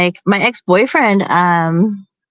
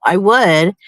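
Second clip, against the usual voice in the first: usual speaking voice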